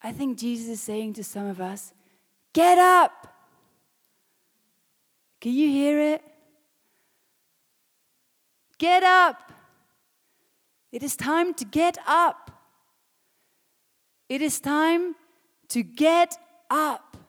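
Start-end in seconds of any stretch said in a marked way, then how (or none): 2.5-3.3 full-force preacher's shout, pitched high; loud, high rallying cry; commanding shout. Acted. Preacher voices Jesus rousing the congregation. 5.4-12.5 high, loud preaching at full force; urgent, raised rallying call; rousing shout. Real, not acted. 14.3-17.2 high, loud, each word hammered out; forceful preacher's cry, drawn out at the end; emphatic shout. Real, not acted. Shouted exhortation, later repeated softly.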